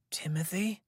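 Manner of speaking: breathless, barely voiced whisper; weak whisper. A narrator's reading. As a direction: whisper it weakly, in shock. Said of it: "he said in a weak voice".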